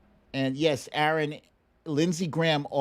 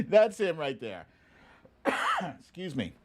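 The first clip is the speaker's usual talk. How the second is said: ordinary talk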